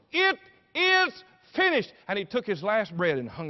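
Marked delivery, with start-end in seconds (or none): 0.1-1.9 high, loud preacher's proclamation; triumphant cry. Acted. Jesus utters his last words from the cross, loud cry. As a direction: build to slow, separately shouted words.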